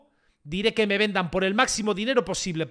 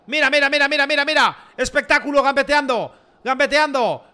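louder, higher, rapid-fire excitement; excited play-by-play; 5 semitones higher, 6 dB louder than usual. Real, not acted. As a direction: call the dribble with mounting thrill. Excitedly following own dribble.

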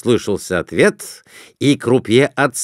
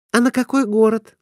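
put-on high, girlish voice; eager wife asking to place a bet; young wife's voice; 12 semitones higher, faster than usual. A narrator's reading. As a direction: voice the wife much higher.

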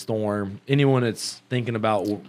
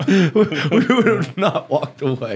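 laughing; 4 semitones higher, 4 dB louder, slower than usual. Real, not acted. Laughing repeat of friend's joke.

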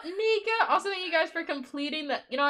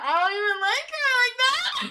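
mock shouted quote; 8 semitones higher, 5 dB louder than usual. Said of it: "talking about".

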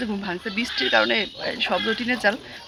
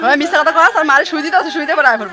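a much louder teasing tell-off; mock scolding; 5 semitones higher, 10 dB louder than usual. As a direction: pretend to scold the fibbing child. Mock scolding a lying child. (মিথ্যা বলা বাচ্চাকে মজার ধমক)

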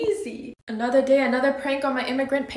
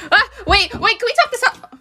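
panicked pleading; 8 semitones higher, 6 dB louder than usual. Real, not acted. Begging mom not to hit.

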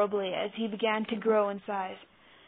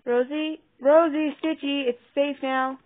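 put-on raised, louder call to children upstairs; 5 semitones higher, 7 dB louder than usual. A narrator's reading. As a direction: call them down, higher and clear. Calling the others from upstairs.

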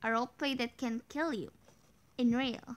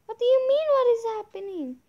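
shocked; 11 semitones higher, 10 dB louder, faster than usual. Real, not acted. Sudden confusion in game.

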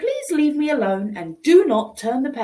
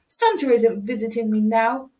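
ordinary delivery, nothing marked

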